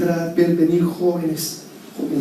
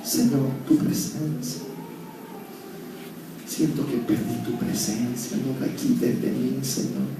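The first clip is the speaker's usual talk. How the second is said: soft, hushed pleading prayer; quiet prayer; 2 semitones lower, 7 dB quieter, slower than usual. Acted. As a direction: whisper David's plea to the Lord. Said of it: "¿Sabe qué decía él?". (oración suave y suplicante de David)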